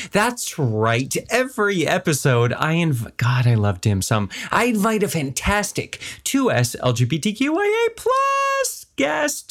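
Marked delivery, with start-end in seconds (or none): 4.5-9.5 hammed-up show intro. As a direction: sweep up high through the long list. Host hams up show intro.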